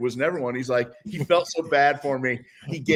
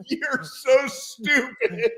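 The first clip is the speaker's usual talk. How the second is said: very slow, higher jeer; mocking taunt; 12 semitones higher, much slower than usual. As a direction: drag it out to taunt him. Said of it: "everyone's like".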